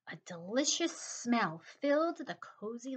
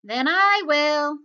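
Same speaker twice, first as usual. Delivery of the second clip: loud, slow, higher hen voice; little red hen; 4 semitones higher, 11 dB louder, slower than usual. A narrator's reading. Hen volunteers when all others refuse, slow loud declaration. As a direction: declare it firmly as the storybook hen.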